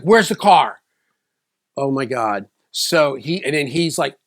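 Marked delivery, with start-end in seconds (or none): 0.0-0.8 dad demanding. Retold or imitated.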